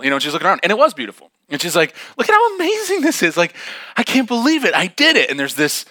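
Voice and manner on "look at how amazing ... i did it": very high, loud gushing; hammed-up triumphant amazement; proud excitement. Girl on her false summit, mock proud excitement. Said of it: "pretty proud of herself and pretty excited".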